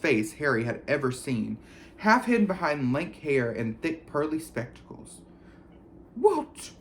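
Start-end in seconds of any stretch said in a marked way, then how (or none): 6.2-6.7 high, sulky snap. A narrator's reading. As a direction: sulk like a moody ghost. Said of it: "she said sulkily".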